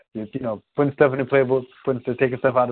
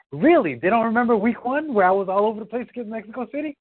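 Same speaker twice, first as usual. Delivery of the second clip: incredulous coworker; 8 semitones higher than usual. Retold or imitated.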